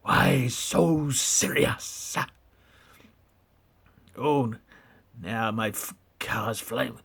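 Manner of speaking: very slow, drawn-out, menacing; slow breathy Joker taunt. Pretend. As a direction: stretch it out like the Joker. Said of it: "Heath Ledger".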